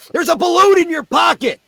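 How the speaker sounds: put-on high shouted kids' voices; kids shouting. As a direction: call it out like excited kids. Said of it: "And they go".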